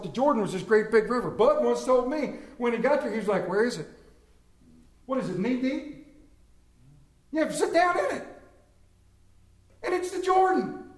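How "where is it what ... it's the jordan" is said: high, indignant retold complaint; outraged at a tiny creek, pitched up; mad disbelief. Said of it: "And he's so mad".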